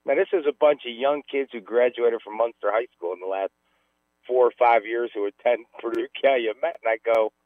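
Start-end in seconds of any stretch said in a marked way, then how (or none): none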